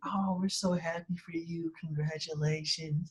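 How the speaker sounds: high, soft, slow mom impression; sleepy mom's gentle congrats; underwhelmed mom. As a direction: imitate mom's mild congratulations, drawn out.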